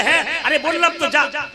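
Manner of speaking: doting king. Acted. King promises the boons.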